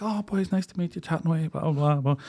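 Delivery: light Northern Irish hostess chatter; mock hostess voice. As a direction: gush like a friendly hostess.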